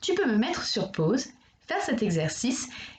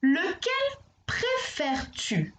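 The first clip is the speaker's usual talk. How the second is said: slow question; 8 semitones higher, slower than usual. Acted. Teacher asks pupil question.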